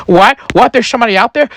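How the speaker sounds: high-pitched, startled questions; acted high-voiced alarm. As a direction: panic as if someone is outside. Startled man voiced in story.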